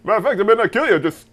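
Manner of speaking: Dangerfield-style mob threat, loud at end; Rodney Dangerfield hitman. Retold or imitated. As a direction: play a joking hitman with no respect. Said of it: "Rodney Dan- as a hitman".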